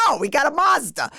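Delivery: indignant mock yell; angry husband. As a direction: imitate an exasperated husband correcting you. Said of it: "he was so angry".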